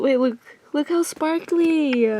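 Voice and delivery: usual speaking voice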